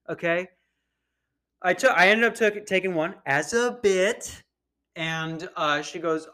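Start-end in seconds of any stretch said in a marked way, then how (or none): none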